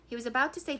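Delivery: ordinary delivery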